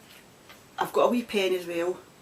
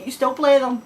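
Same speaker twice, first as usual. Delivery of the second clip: loud call; 8 semitones higher, 10 dB louder than usual. Retold or imitated. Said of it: "they'll say".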